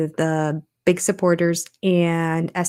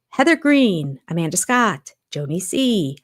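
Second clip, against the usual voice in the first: ordinary delivery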